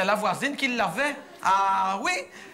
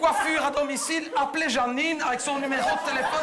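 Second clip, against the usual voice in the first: reading the sign out, voice high; 7 semitones higher than usual. Retold or imitated. Reading the roof sign to the audience, mock ad voice. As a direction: announce it like an ad. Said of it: "reading the neighbour's roof sign".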